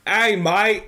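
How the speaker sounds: put-on playful Australian accent on a friendly hello. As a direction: toss off a mock Australian greeting. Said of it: "it's supposed to be Australian".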